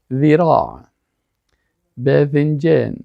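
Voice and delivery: ذ in ذراع and باذنجان voiced at the tongue tip, like "this"; tongue-tip dhal. Shown as a demonstration. Teacher demonstrating dhal for students, tongue-tip pronunciation.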